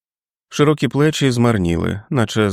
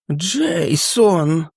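trembling whisper; 9 semitones higher than usual. Acted. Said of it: "прошепотів він тремтячим голосом".

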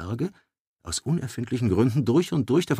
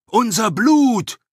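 much higher, louder, slow roar; 11 dB louder, slower than usual. Acted. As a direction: bellow it at the crowd. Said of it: "brüllte Freiherr Willibert". (gebrüllt, laut und langsam)

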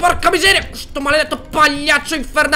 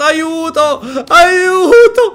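louder cry for help; calling for help; 3 semitones higher, 4 dB louder, much slower than usual. Real, not acted. Gamer lost in the level, calling for help. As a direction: call out helplessly.

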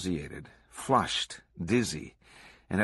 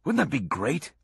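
higher-pitched, enthused exclamation; gushing with excitement; excited exclamation; 6 semitones higher than usual. A narrator's reading. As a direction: exclaim it like a thrilled fan. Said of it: "Swango exclaimed after the interview".

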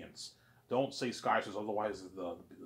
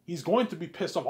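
raised warning; 7 semitones higher, 11 dB louder than usual. Retold or imitated. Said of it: "I'm like".

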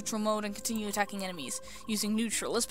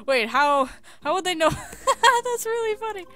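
laughing; 6 semitones higher, 8 dB louder than usual. Real, not acted. Cracking up at own reading.

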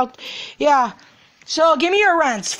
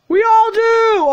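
excited shout; 8 semitones higher, 5 dB louder, slower than usual. Acted. Kids answering field trip call.

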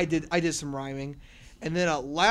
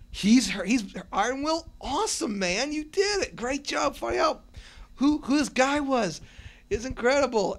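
imitating excited fans; 9 semitones higher, 4 dB louder than usual. Quoting excited fans online.

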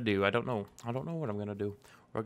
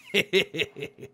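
happy laugh; 5 semitones higher than usual. Real, not acted. Laughs after taming horse.

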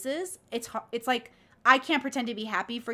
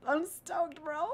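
put-on higher-pitched, giddy teen boy voice; lightly put-on high school boy; excited high school boy; 5 semitones higher, 4 dB quieter, much slower than usual. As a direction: play an eager schoolboy, pitched up. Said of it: "sounded like a high school boy".

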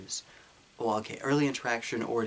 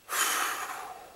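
weary sigh. Real, not acted. Weary at Jigglypuff question.

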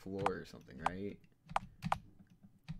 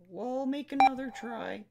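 voicing the game character much higher, a touch louder; 14 dB louder than usual. Gamer voicing the game character at the terminal, high and squeaky.

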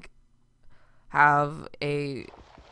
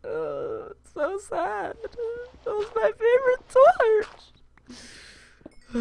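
mock sadness; 3 dB louder than usual. Mourning a broken torch.

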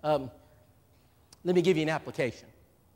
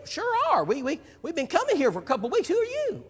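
much higher, friendly visitor voice; voicing the visitor; 13 semitones higher, 3 dB louder than usual. Preacher voicing the visiting husband, much higher and chirpy. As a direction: play the cheerful newcomer at church. Visitor answers in retold scene.

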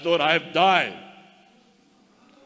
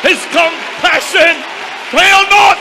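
full-force preaching shout, much higher and louder; shouted praise; 12 semitones higher, 9 dB louder, slower than usual. Real, not acted. Preacher praises God's mercy to the saints, shouting. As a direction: cry it out to the church, chanting.

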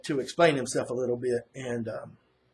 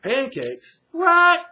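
incredulous squeak on the final word, much higher and louder; surprised; 8 dB louder, much slower than usual. Retold or imitated. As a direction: retell Carl's disbelief at pancakes. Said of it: "So Carl's like".

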